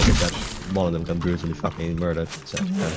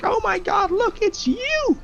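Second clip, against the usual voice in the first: much higher, overjoyed pet voice; excited dog voice; slower than usual. Pretend. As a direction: squeal it out like a dog thrilled to see you. Excited dog greeting visitor.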